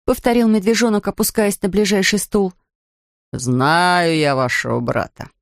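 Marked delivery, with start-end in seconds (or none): none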